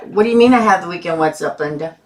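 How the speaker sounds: ordinary talk, nothing marked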